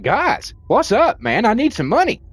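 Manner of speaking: high, loud mock voice of a pal begging for cash; mock moocher. Streamer mocking a beggar he isn't, high and loud. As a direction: ham up the sleazy buddy asking for money. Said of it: "to be like".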